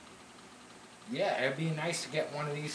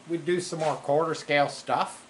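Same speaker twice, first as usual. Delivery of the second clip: ordinary delivery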